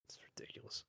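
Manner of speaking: breathy whispered aside, almost voiceless. Real, not acted. Caster whispering an aside about the read, whispered.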